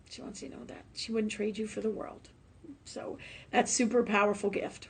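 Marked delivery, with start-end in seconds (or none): 0.1-0.8 whisper. Real, not acted. Emotional repeat, breath only. 0.9-3.2 much softer, near whisper; emotional hush. Real, not acted. Repeating the mother's words, softly and emotional. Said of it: "I'm getting all emotional".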